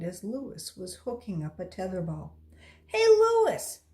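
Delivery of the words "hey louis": loud, high call across the playground. Acted. Damien calling to Louis on the playground, loud high call. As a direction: shout his name to catch his attention. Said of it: "Damien called".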